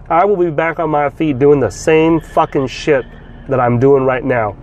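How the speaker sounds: normal talking voice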